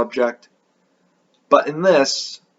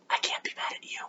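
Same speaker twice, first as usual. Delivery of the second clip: hushed whisper, much softer; whispered aside; 16 dB quieter, faster than usual. Pretend. Soft aside before contradicting himself.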